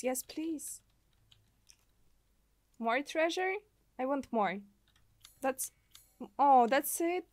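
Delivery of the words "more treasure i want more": normal talking voice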